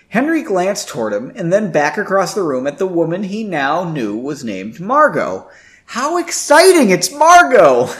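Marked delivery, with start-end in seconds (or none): 5.9-7.9 mock excitement. Mocking the lovestruck hero.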